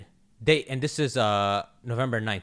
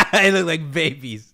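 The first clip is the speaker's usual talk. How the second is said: loud, high, slowed by laughter; laughing; 5 semitones higher, 10 dB louder, slower than usual. Real, not acted. Host reacting to tiny dogs in photo, laughing. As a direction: blurt it out while cracking up.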